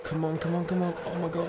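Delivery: high tense come on; frantic pleading. Real, not acted. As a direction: urge it on in fear. Still fleeing the dogs.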